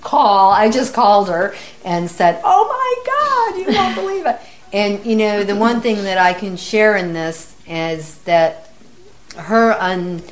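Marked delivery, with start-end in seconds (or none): 2.4-4.4 much higher, stretched-out exclamation; astonished excitement. Retold or imitated. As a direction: relive the thrill of breaking incredible news. Retells her excited phone call.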